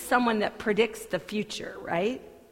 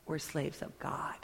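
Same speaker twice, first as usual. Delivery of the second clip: whispered, soft and intimate; hushed whisper; 6 semitones lower, 10 dB quieter than usual. Acted. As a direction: let it drop to a whisper. Preacher voicing Peter's punchline.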